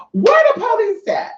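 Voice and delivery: high, incredulous squeal; exasperated. Retold or imitated. Exasperated retold reaction.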